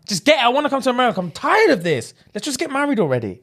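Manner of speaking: high-pitched mock exasperation; impatient outburst. Man wanting his visa from his partner, imitated fed-up rant. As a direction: plead impatiently, voice pushed up high.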